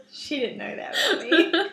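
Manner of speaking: laughing through the words. Real, not acted. Confessing her goofy side to the host, laughing. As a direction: giggle it out, a little embarrassed. Laughing about doing impressions.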